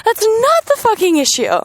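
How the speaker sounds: furious, voice jumping up; real anger. Real, not acted. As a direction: let the frustration burst out high. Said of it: "It makes me so angry".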